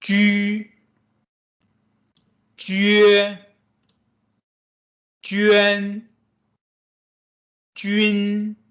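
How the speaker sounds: ju, jue, juan, jun read as clear model syllables; teacher modeling Mandarin syllables; Mandarin syllables demo. Shown as a demonstration. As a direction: model each syllable slowly for the class. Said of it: "Let's read them together".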